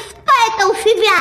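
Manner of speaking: ordinary delivery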